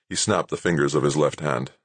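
ordinary delivery

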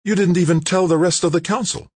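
high, loud, sudden accusation; Jane blurting. A narrator's reading. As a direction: blurt it out, shocked and reproachful. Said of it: "Jane blurted".

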